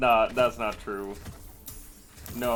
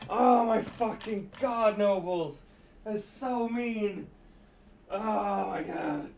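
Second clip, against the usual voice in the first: usual speaking voice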